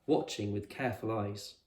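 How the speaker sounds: usual speaking voice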